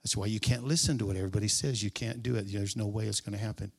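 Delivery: ordinary talk, nothing marked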